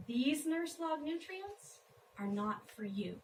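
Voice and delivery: emphatic line openings, falling away at the ends; poem recitation. Acted. Poet chides a prairie girl far from home, poem recitation. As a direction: read as verse with a pause after each line.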